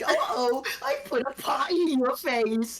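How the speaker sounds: high, mocking cartoon prankster; mock-goofy, high-pitched gag delivery; fifties slapstick gag. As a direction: ham it up like an old slapstick cartoon. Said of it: "a reference to nineteen fifty gag of going".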